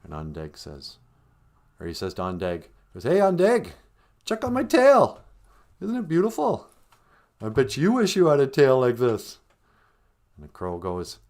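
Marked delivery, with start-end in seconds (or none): 3.0-9.4 high, loud bragging call; boastful showing off. Acted. Beaver showing off his tail to the crow, boastful. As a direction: call out proudly, pitched way up.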